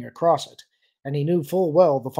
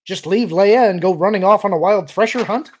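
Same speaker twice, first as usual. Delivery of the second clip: loud, higher, indignant question; incredulous challenge, voice raised; demanding; 6 semitones higher, 10 dB louder than usual. A narrator's reading. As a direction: build in outrage to a rising end. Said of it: "Han demanded".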